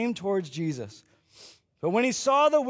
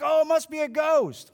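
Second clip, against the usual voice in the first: freaking out; 7 semitones higher than usual. Retold or imitated. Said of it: "they freak out".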